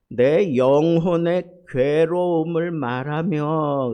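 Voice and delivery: slow, loud, weighty reading of the verse; emphatic scripture quote. Acted. Pastor proclaiming Job's verse to the church, slow and loud. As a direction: proclaim each word of the verse.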